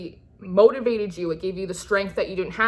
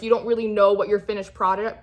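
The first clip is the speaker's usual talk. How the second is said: ordinary talk, nothing marked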